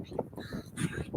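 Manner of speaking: barely whispered groan of embarrassment; mortified whisper. Retold or imitated. Mom whispers to herself when mistaken for his date. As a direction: breathe it out under your breath, mortified. Embarrassed reply to concert stranger.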